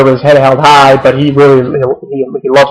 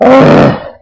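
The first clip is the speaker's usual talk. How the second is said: exasperated sigh; 11 semitones higher than usual. Real, not acted. Disgusted at wrestling booking.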